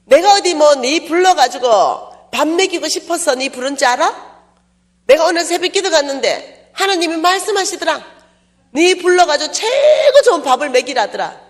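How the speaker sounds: high, loud insistent friend's voice. Retold or imitated. Friend telling the preacher God ordered the meal, high insistent voice. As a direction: press her with God's word.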